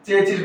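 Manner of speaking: चेसिस as "CHE-chis", falling at the end; common mispronunciation. Shown as a demonstration. Teacher quoting what we wrongly say, mispronounced "che-chis". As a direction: say चेसिस with ch twice. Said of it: "गलत करते हैं".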